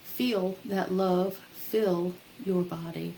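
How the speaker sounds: soothing, unhurried guide through breathing; guided meditation. Acted. Leads loving kindness meditation.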